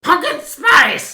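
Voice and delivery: very high, loud, slow screech; screeching monster. Retold or imitated. Mocking a cartoon woman, high slow screech. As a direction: wail it like a cartoon ghoul.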